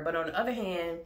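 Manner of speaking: ordinary delivery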